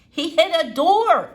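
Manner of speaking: emphatic Flora. A narrator's reading. Girl explaining the accident, emphatic and slow. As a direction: spell it out for the doctor.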